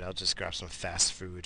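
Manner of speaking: normal talking voice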